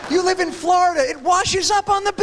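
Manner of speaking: pushes up into a yelling rant; rising exasperated tirade. Real, not acted. Exasperated ranting.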